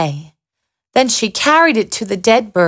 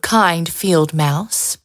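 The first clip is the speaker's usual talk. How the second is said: plain reading voice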